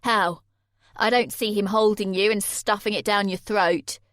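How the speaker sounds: loud, hard accusing question; angry boyfriend. Acted. Jay accusing Sammy, loud and bitter. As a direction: push the disbelief at her.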